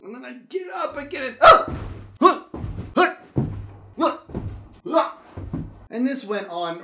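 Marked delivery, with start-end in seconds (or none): 1.4-5.2 loud high yelps and grunts; a string of loud high yelps; repeated falling yelps. Retold or imitated. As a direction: cry out with each fall. Repeated falls on flat ground.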